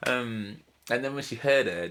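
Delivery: normal talking voice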